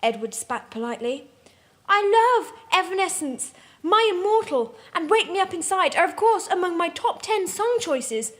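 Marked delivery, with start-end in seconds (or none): 1.9-8.3 high, gushing fangirl voice, pitched up; happy gushing. A narrator's reading. Teen girl gushing to Edward about Evanescence, high and giddy. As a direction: gush over the band like a swooning teen. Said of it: "I happily exulted".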